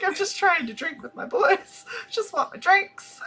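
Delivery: high-pitched mock whine; comically desperate retold plea; mock pleading. Retold exasperated plea at bar.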